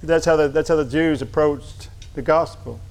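usual speaking voice

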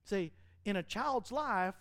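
ordinary talk

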